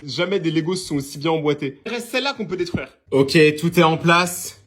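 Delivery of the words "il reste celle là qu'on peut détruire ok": normal talking voice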